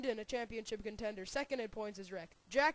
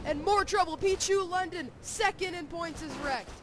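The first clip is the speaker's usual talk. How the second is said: excited; 6 semitones higher, 5 dB louder than usual. Real, not acted. Big wreck called live.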